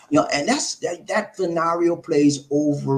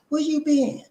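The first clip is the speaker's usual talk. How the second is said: high falsetto auntie; high-pitched auntie impersonation; 14 semitones higher than usual. Acted. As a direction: greet him in a put-on aunt voice. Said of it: "my auntie".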